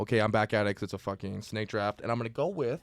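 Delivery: normal talking voice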